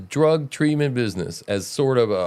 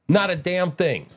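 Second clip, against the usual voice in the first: ordinary talk, nothing marked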